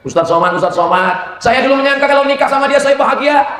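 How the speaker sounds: high-pitched complaining wife. Retold or imitated. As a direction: plead to the preacher as a regretful wife. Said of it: "Pengakuan seorang istri".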